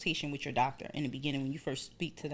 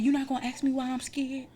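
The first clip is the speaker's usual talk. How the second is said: higher-pitched, put-out challenge; mock indignation, pitched up; retold indignant question; 8 semitones higher than usual. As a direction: act out a scared patient's protest. Acting indignant question to doctor.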